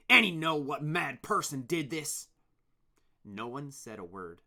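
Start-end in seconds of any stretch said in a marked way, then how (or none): 0.1-2.3 angry. Acted. Angry leader questions workers.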